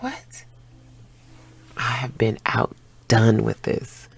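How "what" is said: high, soft disbelieving what; stunned whisper. Retold or imitated. Woman reacting to his caring question, high stunned whisper. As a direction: gasp it out softly.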